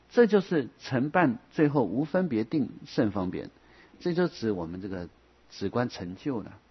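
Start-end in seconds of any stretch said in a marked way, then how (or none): none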